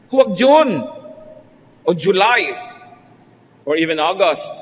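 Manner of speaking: a sudden loud warning. Real, not acted. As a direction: warn them loudly against June. Loud warning against June weddings.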